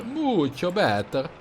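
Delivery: mock Italian accent; hammy Italian, stretched slow. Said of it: "My perfect Italian accent".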